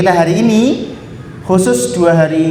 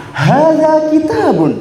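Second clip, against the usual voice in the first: much higher, slow ringing call; bolder; 10 semitones higher, slower than usual. Shown as a demonstration. Teacher asking the class for a bolder voice, ringing. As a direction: project it out boldly. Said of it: "Yang lebih lantang lagi".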